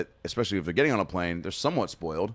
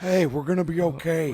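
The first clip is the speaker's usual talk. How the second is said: higher, lightly voiced Cage reassurance; Nicolas Cage impression; 8 semitones higher than usual. Friend repeating the Cage line to a kid, lightly voiced and higher. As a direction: echo the Cage line with a grin. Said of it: "Fucking Nicolas Cage on him".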